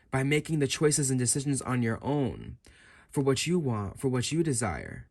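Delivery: normal talking voice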